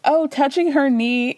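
normal talking voice

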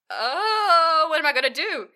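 high, loud whimpering cry; hammed-up despairing whine; mock wail. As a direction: wail like a cornered coward. Cornered character wails.

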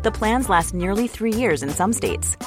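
ordinary talk, nothing marked

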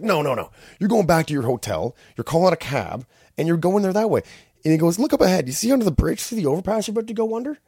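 high, mock-furious cop rant; an imitated cop berating naive tourists; agitated cop. Cop orders them back.

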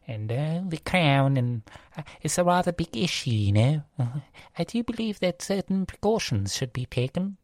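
put-on posh British royal accent; posh, formal Crown-style English accent. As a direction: imitate a stuffy posh royal. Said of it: "that posh accent from The Crown".

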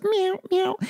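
high little cat meows; high-pitched toddler meow. Retold or imitated. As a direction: squeak it like a toddler being a cat. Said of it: "And he just goes".

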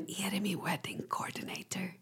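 hushed, conspiratorial whisper; playful whispered question; mock-secret whisper. Conspiratorial guess about woman from Spain.

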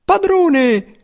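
very high squeaky scream; muffled cry. A narrator's reading. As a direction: sprite crying out from the sack. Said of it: "il grido soffocato dello spiritello".

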